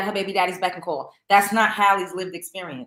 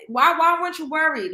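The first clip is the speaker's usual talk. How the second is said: exasperated; 7 semitones higher than usual. Real, not acted. Exasperated demand.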